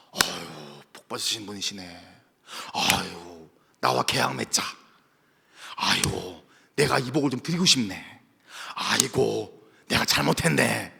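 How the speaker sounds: low, soft fawning voice; fawning visitor. Acted. Visitor fawning over a blessed believer, low and soft. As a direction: grovel and flatter softly.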